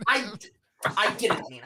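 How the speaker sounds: breaking off and restarting the I; flustered salamander stammering. Acted. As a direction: stumble over the first word. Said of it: "he stammers and is like".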